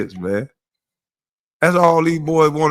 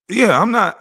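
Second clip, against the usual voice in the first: usual speaking voice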